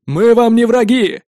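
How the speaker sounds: loud slow call to the walls; shout. A narrator's reading. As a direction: call out from afar. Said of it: "крикнул Гуннар заранее". (крик издалека)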